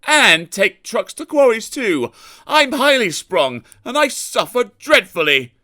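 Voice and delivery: high sheepish little-engine voice; shamefaced and whiny, pitched high. A narrator's reading. Sir Handel sheepishly complaining to Gordon about his work. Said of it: "Sir Handel said sheepishly".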